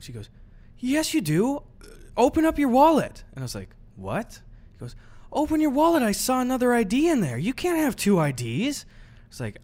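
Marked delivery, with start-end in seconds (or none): 0.8-8.9 high, firm mock cashier; insistent cashier. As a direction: play the cashier demanding the wallet.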